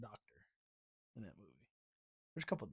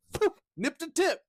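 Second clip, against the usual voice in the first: higher, louder comic sales pitch; showman rabbi; 9 semitones higher, 5 dB louder, slower than usual. Acted. Co-host acting out Rabbi Tuckman's demonstration, comic showman pitch. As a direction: play the rabbi touting for customers.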